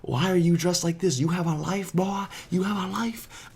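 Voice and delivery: mock him like an aggressive stranger; the stranger mocking the ghillie suit, a high sneering taunt; mock bully. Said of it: "So the guy's like".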